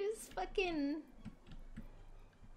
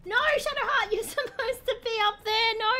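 loud, high, laughing protest at the screen; frustrated gamer yelling at an AI teammate; yelling at Shadowheart; 4 semitones higher, 7 dB louder than usual. Real, not acted. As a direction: shout her back into position. Yelling at companion in game.

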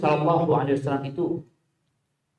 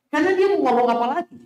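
animated rhetorical question; 13 semitones higher, 5 dB louder, much faster than usual. Real, not acted. Preacher's rhetorical punchline.

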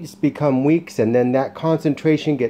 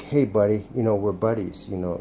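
lower, softer, reassuring buddy talk; soothing voice; 5 semitones lower, 6 dB quieter than usual. Shown as a demonstration. Reassuring the timid dog after a correction, soothing. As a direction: make peace with the dog right after scolding. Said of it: "I'm in a soothing voice".

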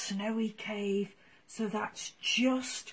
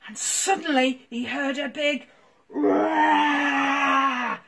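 usual speaking voice

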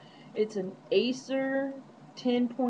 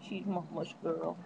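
cooing; 3 semitones lower, 9 dB quieter than usual. Real, not acted. Cooing to the dog.

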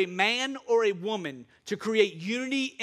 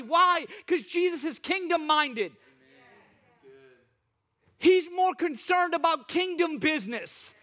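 high, emphatic sermon voice; impassioned pulpit declaration; fervent preaching; 8 semitones higher than usual. Real, not acted. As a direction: proclaim it to the whole church, stretching key words. Preaches to congregation.

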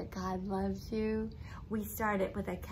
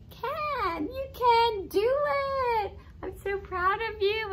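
excited; 13 semitones higher, 6 dB louder than usual. Acted. Enthusiastic praise of child.

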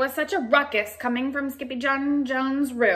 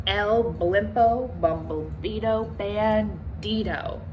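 ominous slow naming; slower than usual. Acted. Dread name of the villain.

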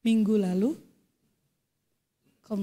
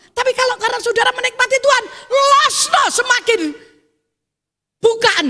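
loud, high-pitched fervent exhortation; full-voiced revival preacher climax; preaching at full force; 10 semitones higher, 8 dB louder than usual. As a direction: drive the congregation on with rising fervour.

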